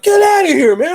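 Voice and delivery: high, loud, giddy mock squeal; excited disbelief. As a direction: relive the thrill of being recognized. Thrilled at being recognized.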